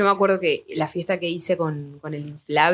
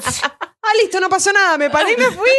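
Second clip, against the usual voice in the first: mock sudden relief; 10 semitones higher than usual. Retold instant relief, high.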